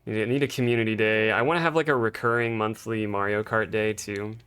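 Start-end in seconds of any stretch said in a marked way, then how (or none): none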